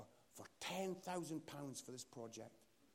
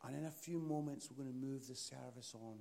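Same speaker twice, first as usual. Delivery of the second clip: low, soft, long solemn pauses; hushed pastoral invitation, phrase by phrase; altar-call prayer; 5 semitones lower, 4 dB quieter than usual. Real, not acted. Preacher to bowed heads about brokenness, softly prayerful.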